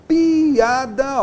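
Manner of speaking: each vowel stretched slowly apart; slow teacher demo word; drawn-out demo. Shown as a demonstration. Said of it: "ó, vogal, vogal".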